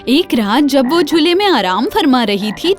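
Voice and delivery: ordinary delivery, nothing marked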